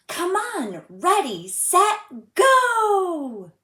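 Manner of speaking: high, loud eager countdown; excited shout. Acted. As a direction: yell it like a kid who can't wait. Child calls out starting trip.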